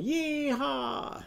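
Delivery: high, drawn-out cowboy yee-haw; cowboy whoop. Acted. Teacher voices the cowboy for children, high whoop. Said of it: "cowboy's going".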